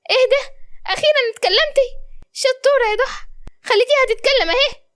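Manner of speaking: very high-pitched, thrilled character voice; delighted surprise. Acted. Habiba praises Doha for finally speaking, delighted surprise. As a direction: marvel at it, voice shooting up.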